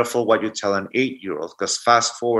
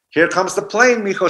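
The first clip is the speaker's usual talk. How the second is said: higher singsong airplane-spoon coaxing; playful grandma; 8 semitones higher than usual. Retold or imitated. Grandmother flying a spoon to grandson's mouth, playful and high. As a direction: make it a game for the child.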